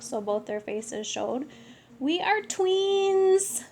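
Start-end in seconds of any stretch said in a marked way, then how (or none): 2.0-3.6 high and slow, savouring it; drawn-out delighted declaration; slow proud reveal. Acted. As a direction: stretch out the big reveal.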